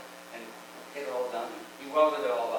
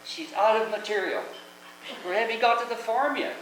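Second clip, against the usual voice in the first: raised, higher mock yell at the driver; retold yell; 8 semitones higher than usual. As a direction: call it up to the driver's window, pitched high. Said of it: "he yells at me".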